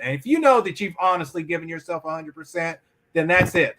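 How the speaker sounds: ordinary delivery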